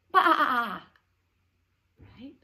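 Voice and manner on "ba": loud high bleating baa; sheep baa. Shown as a demonstration. Teacher bleating like a sheep for the class. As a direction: bleat it out like a lamb. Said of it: "Sheep says".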